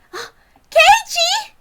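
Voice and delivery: very high, loud call across the yard. Acted. Rena calling Keiichi from the classroom window. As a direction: shout his name from the window.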